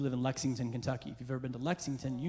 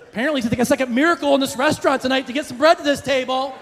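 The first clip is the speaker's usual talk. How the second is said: high, very loud comic tirade; outraged rant; 13 semitones higher, 14 dB louder than usual. Acted. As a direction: yell in hammed-up indignation over a trifle. Mock outburst over forgotten bread.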